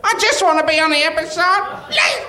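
high, hammed-up begging wail; mock pleading. Co-host begs to be on the last episode, mock pleading. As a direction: beg like a desperate hanger-on.

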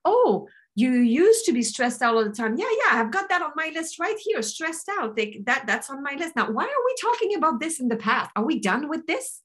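high, busy assistant checking a list; puzzled personal assistant. Acted. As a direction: play a perky clerk growing puzzled. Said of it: "your personal assistant listens to this and it says".

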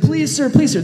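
high, pleading please sir; frantic begging. Retold or imitated. Debtor on his knees begging the king, high and frantic. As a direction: beg on your knees, voice climbing. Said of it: "the guy freaks out ... he's like".

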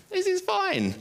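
very high, squeaky fake reassurance; mock-fine. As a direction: insist it in a comically strained high pitch. Pretending to be fine in grief.

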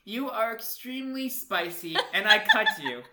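slow, loud, hammed-up declaration; love letter reading. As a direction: read it out like a grand love letter. Reads the silly love letter aloud.

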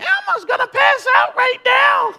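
very high, loud squeaky puppet shout; overheated Elmo about to faint. Retold or imitated. Sweating dad announcing collapse in high Elmo voice. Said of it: "I said".

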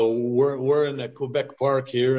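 usual speaking voice

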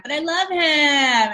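hammed-up lovestruck sigh; lovestruck woman. Women defending a bad partner, slow lovesick plea. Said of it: "women do this all the time".

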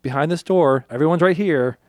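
high, loud, rushed reassurance; woman panicking at gunpoint, shouting to placate him; frantic pleading. Retold or imitated. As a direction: blurt it out to calm an armed man. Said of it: "she's like".

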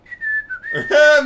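high, loud whistle; imitated whistling. Storyteller mimicking the security man, showy loud whistling. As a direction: whistle like a guard strolling off. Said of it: "Mit Pfeifen?".

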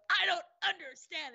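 high-pitched, whiny retold protest; exasperated protest. Traveler told she must be wanded, exasperated protest. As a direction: wail it like someone at wits' end. Flopping arms, exasperated at screener.

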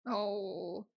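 soft, tearful "oh"; small, pained sigh of sympathy; moved to sadness. Real, not acted. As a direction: let out a quiet little whimper. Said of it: "This movie was really sad".

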